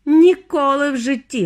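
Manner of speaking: angry fatherly shout; barked refusal. A narrator's reading. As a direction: snap it out loudly. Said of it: "гаркнув пан Т В".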